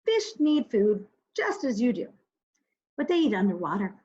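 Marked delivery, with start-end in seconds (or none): none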